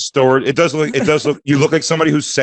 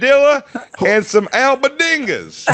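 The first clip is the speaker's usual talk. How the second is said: mock customer order; 12 semitones higher than usual. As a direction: squeak out the order like a fussy customer. Mock customer ordering, much higher.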